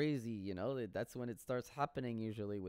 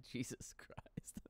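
a soft, slow, exasperated whisper; whispered jesus christ; 12 dB quieter, slower than usual. Real, not acted. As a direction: breathe it out under your breath. Exasperated aside to himself.

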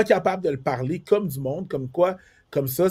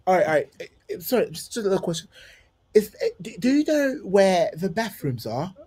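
higher, politely hesitant London English; London English accent; 6 semitones higher than usual. Acted. Newly landed traveller asking his way, London accent. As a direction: blend in with a put-on London accent. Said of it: "Je vais parler comme eux".

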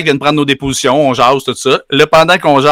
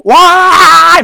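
high yelp, much louder; loud exclamation; 7 dB louder than usual. Retold or imitated. The speaker mimicking the truck's roaring engine, a high yelp. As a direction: blurt it out like an engine roar.

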